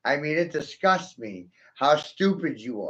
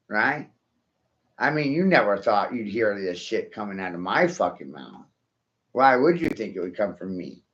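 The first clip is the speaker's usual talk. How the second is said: ordinary delivery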